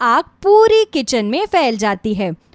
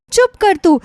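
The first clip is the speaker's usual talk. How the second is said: loud, higher, stern rebuke; sharp scolding; 7 semitones higher, 4 dB louder than usual. Acted. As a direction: cut her off firmly. Mother-in-law scolds injured daughter-in-law. (डांटते हुए ऊँची आवाज़ में)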